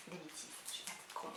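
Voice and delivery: whispered aside. Real, not acted. Muttered self-correction aside.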